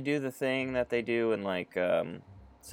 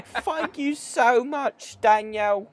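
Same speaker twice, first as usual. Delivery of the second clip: high, slow, put-on London accent; 12 semitones higher, 5 dB louder, much slower than usual. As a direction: thank him like a posh-ish Londoner. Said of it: "she speaks like [name]".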